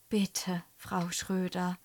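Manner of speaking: hushed, pleading little voice; whispered plea. A narrator's reading. Pupil begs teacher not to look, whispering. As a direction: plead softly, almost whispering. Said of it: "flüstert Jan Arne".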